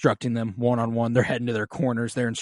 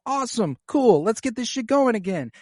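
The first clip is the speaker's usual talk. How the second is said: put-on high, eager kid ready to go again; pumped-up kid; 10 semitones higher than usual. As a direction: bounce back up all fired up. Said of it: "and Jace is like".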